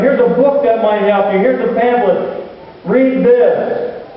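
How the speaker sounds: mock distant helper. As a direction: hand it down like a detached do-gooder.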